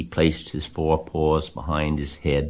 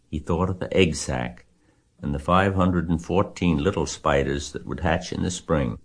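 usual speaking voice

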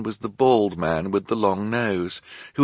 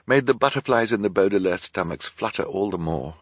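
ordinary delivery

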